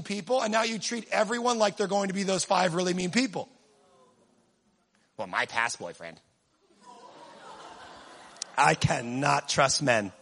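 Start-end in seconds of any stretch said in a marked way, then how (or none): 5.2-10.1 bitter mock voice. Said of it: "mocking the bitter ex".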